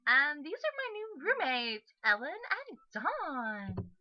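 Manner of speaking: put-on high, loud, swooping girl's voice; perky introducing sister. Sister introducing new roommates, high and perky. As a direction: swing the pitch up, drawing out names.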